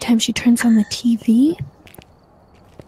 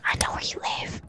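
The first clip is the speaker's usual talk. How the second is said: softer, eerie hushed whisper; creepy whisper; 11 dB quieter, faster than usual. Pretend. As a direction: drop to a menacing whisper. Same threat, now whispered.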